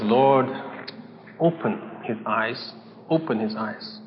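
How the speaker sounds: soft, low, slow plea to God; quiet prayer. Retold or imitated. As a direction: pray it under your breath. Elisha praying for his servant.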